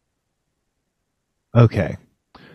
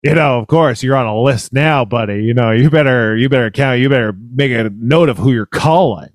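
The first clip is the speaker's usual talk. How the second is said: lightly mocking, self-satisfied warning; really smug; 2 semitones lower than usual. Radio host advising a paranoid caller, mock smug. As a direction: imitate a smug know-it-all radio host. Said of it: "like, really smug".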